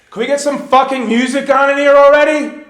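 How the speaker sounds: high, loud furious demand; angry yell. Retold or imitated. Rock star yelling for music in the dressing room. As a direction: yell it in fed-up rage.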